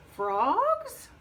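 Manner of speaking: high, stretched-out question; hopeful guess, voice climbing; guessing frog. Acted. Frog guesses at Wolf's riddle, hopeful.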